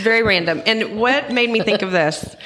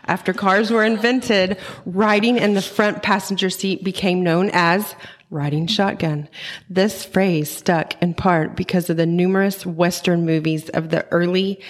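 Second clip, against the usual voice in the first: ordinary delivery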